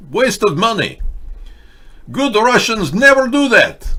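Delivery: put-on over-the-top Russian accent. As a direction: play a proud Russian comic in a thick Russian accent. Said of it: "It is ridiculous to say that I do Russian accent".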